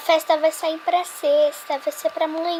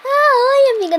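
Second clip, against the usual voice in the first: bright greeting; 11 semitones higher, 11 dB louder than usual. Pretend. Doll suddenly greets friend.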